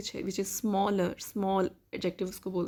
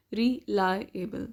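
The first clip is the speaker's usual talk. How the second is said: "reliable" slowly broken into re-lie-able; syllable by syllable; much slower than usual. Shown as a demonstration. Teacher counting syllables for learners, "reliable" broken up slowly. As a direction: sound out "reliable" in separate, slow parts. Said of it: "It has three syllables".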